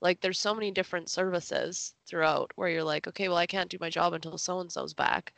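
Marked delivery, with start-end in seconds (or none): none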